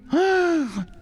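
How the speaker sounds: sharp high gasp of alarm; startled intake of breath; shocked gasp. Retold or imitated. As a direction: gasp at the sight. Sees two hundred riders behind.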